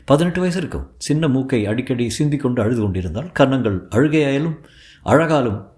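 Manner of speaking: usual speaking voice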